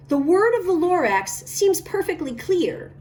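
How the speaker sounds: ordinary delivery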